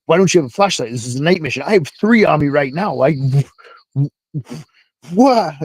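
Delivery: sputtering into a baffled, high what; exasperated disbelief. Retold or imitated. Spiraling, berating cook about flashlight.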